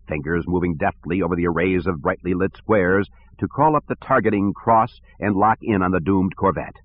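usual speaking voice

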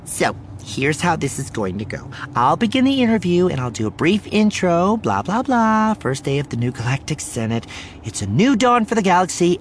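polished, upbeat broadcaster briefing a guest; brisk presenter figure. Acted. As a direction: run through the plan like a confident host. Voices Tracine briefing her guest.

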